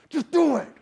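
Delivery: urging. Retold or imitated. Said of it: "half of my monkey brain was like".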